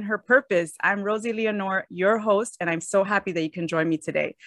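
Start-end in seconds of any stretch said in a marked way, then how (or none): none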